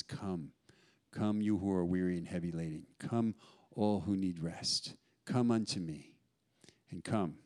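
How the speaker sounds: calm, unhurried voice of Jesus calling; tender invitation. Acted. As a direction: invite the weary in gently.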